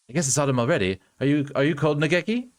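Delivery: ordinary talk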